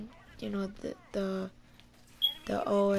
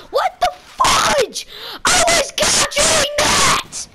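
screaming in real rage, much higher and louder; furious yelling; 12 semitones higher, 10 dB louder than usual. Real, not acted. Gamer raging at teammates, furious shout. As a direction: blow up at the game at full volume.

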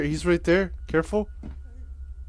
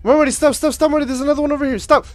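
panicked shouting; 7 semitones higher, 4 dB louder, faster than usual. Real, not acted. Chased, yelling at friend.

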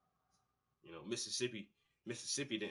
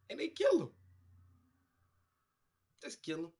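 usual speaking voice

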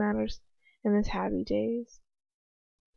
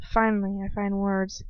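ordinary delivery

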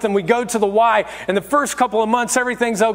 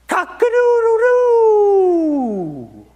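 long, high, loud rooster crow; drawn-out cock-a-doodle-doo falling away at the end; 13 semitones higher, 4 dB louder, much slower than usual. Acted. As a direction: crow like a rooster, stretching the last note. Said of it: "what you'll hear is this".

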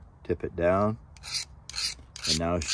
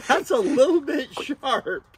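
high laughing words, louder; 7 dB louder, slower than usual. Real, not acted. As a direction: laugh through it, surprised. Pricked by the sharp blade.

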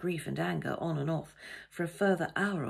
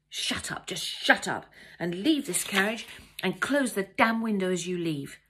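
angry, rising pitch and volume; 3 semitones higher, 6 dB louder than usual. A narrator's reading. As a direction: drive him out with mounting anger. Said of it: "My voice was rising in pitch and volume".

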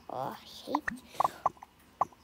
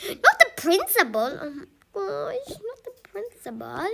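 mock dread; 6 semitones higher, 9 dB louder than usual. Kids dreading the principal.

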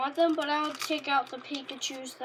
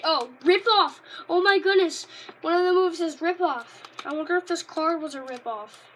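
loud, higher exclamations of disbelief; mock outrage; 3 semitones higher, 10 dB louder than usual. Real, not acted. Kid reviewing a weak card, loud disbelief. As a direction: gasp at how bad the card is.

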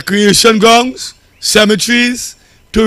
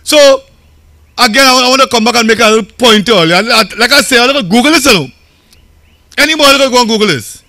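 normal talking voice

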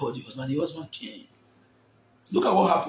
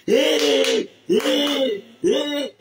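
retold shouting; 7 semitones higher, much slower than usual. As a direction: shout it at the trapped car. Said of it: "She came down from a vehicle and said".

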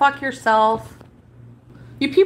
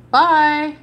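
mocking bye; 4 semitones higher, 6 dB louder than usual. Real, not acted. Mocking goodbye after blocking.

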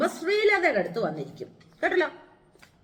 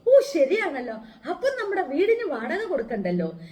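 laughing; 5 semitones higher, 4 dB louder than usual. Retold or imitated. Gleeful inner thought, laughing.

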